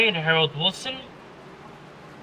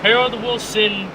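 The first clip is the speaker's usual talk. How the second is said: motorsport commentator; 5 semitones higher than usual. Acted. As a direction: call the restart with mounting excitement. Race restart play-by-play.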